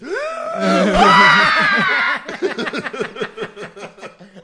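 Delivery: high shrieking burst of laughter; loud shrieking laugh. Real, not acted. Huge laugh at joke.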